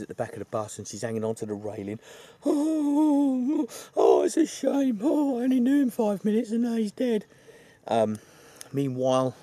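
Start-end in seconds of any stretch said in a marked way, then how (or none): 2.4-7.2 high, loud, hammed-up sobbing voice; mock grieving woman. Melissa clings to the bus railing, wailing in a high mock voice. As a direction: overplay a heartbroken woman.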